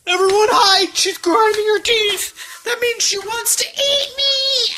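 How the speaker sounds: high, loud hammed-up alarm; playful fake fright, warning everyone; mock panic. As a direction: pretend to be terrified of the baby. Parent mock-fears toddler's teeth grinding.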